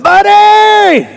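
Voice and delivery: high, loud call across the yard; calling the dog. Retold or imitated. Pastor calling out for the neighbor's dog, loud and high. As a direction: shout the dog's name to find him.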